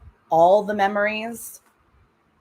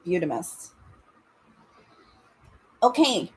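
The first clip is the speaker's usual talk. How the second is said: usual speaking voice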